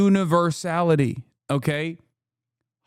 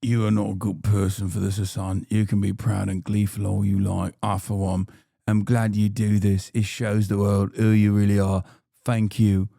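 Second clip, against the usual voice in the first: much lower, mock-solemn message reading; low put-on reading voice; 8 semitones lower than usual. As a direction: read it out deep and deliberate. Reads a viewer message in low voice.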